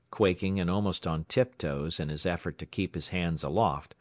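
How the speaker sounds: ordinary delivery, nothing marked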